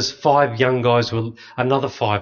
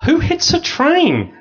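incredulous; 4 dB louder, slower than usual. Real, not acted. Disbelief at being hit by train.

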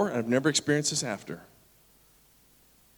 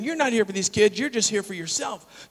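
accusation; 6 semitones higher than usual. Retold or imitated. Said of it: "she started impugning our character".

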